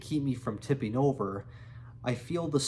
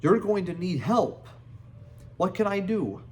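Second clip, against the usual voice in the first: put-on thick Australian accent; 5 semitones higher than usual. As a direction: bark it out like a tough Australian ex-soldier. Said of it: "he barks out in a thick Australian accent".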